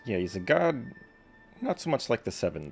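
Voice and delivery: usual speaking voice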